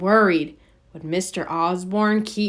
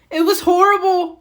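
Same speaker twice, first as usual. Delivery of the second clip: high, louder distressed cry; a shaken victim crying out; upset cry; 12 semitones higher, 5 dB louder than usual. A narrator's reading. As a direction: wail it out, shaken up. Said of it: "cried the man".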